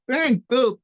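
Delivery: high goofy Jerry Lewis squawk. Retold or imitated. Comic imitating Jerry Lewis in dance class, high and goofy. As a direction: squawk it like the Nutty Professor.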